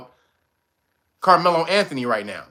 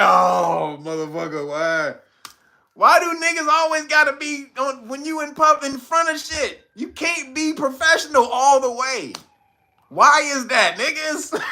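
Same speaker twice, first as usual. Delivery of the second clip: high exasperated complaining; a hint of a fed-up father; exasperated dad; 8 semitones higher than usual. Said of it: "The dad was in the back like".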